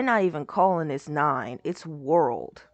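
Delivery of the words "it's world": normal talking voice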